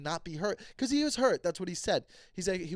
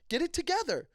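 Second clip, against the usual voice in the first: "together" pitched much higher in exasperation; exasperated; 9 semitones higher than usual. Real, not acted. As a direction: rise up in frustration on "together". Scolding friend, repeated.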